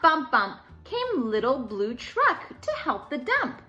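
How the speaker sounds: ordinary delivery, nothing marked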